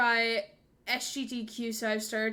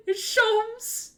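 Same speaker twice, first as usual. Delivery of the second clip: much higher, louder delighted squeal; excited squeal; 11 semitones higher, 6 dB louder than usual. Real, not acted. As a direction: shriek it like a thrilled fan. Fangirl scream at character.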